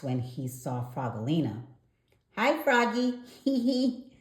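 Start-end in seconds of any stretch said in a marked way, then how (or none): 2.4-3.4 put-on high, bright little girl frog voice, giggling; a sweet little frog girl offering help; Frogolina's voice. A narrator's reading. Frogolina greets Froggy, a lightly voiced girl frog. 3.4-4.1 put-on high girlish giggle. A narrator's reading. Frogolina giggles at Froggy, a high giggle.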